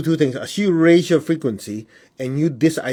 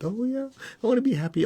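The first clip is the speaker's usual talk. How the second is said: much higher, playful delight; dreamy delight; 10 semitones higher, 5 dB quieter than usual. Retold or imitated. Student imagining happiness, higher and delighted. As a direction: gush it like a Peter Pan moment. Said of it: "Peter Pan moment".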